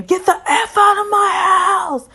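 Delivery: high, loud shouted order; yelling. Retold or imitated. Angry husband throwing wife out, shouting. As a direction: scream him out of the house. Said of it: "he's still saying".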